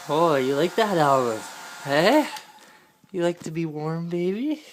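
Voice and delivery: ordinary talk, nothing marked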